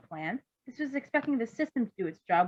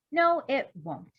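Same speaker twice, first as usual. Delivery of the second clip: firm agreement; 6 semitones higher, 4 dB louder, much slower than usual. Acted. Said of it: "the doctor agreed".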